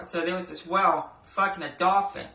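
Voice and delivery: ordinary talk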